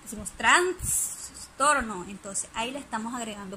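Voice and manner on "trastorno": trastorno drawn out slowly and louder with an extra sound inside; extra sound added. Shown as a demonstration. Teacher demonstrating epenthesis to the class, slow and louder. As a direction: stretch trastorno slowly, loudly, inserting a sound. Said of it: "en vez de decir trastorno".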